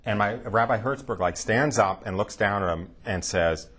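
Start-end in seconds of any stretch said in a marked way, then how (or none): none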